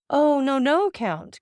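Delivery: high, emphatic protest falling away; cried out. A narrator's reading. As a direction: cry it out in earnest protest. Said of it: "cried Maximilian".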